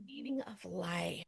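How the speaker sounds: slow breathy whisper to herself. Real, not acted. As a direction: murmur it slowly, almost unvoiced. Musing on a deep question.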